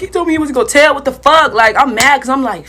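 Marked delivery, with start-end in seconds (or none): 0.0-1.5 betrayed outrage. Retold or imitated. Outraged at being told on.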